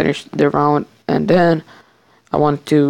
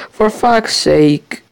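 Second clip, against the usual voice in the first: frustrated; 5 semitones higher, 3 dB louder, much slower than usual. Real, not acted. Frustrated in game.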